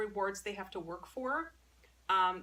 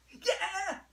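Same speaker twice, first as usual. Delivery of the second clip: high-pitched praise to a dog; excited cheer; 11 semitones higher than usual. Real, not acted.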